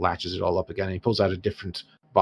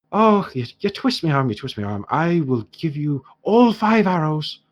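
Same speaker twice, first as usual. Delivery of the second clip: Irish dwarf trader, mock-pained; aggrieved dwarf shopkeeper; 6 semitones higher, 5 dB louder than usual. Dwarf knocks off one gold, hammed-up Irish grievance. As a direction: haggle as though every coin hurts.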